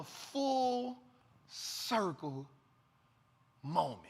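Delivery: very slow, word by word; savouring. Real, not acted. Trapper having his full circle moment, slow savouring delivery.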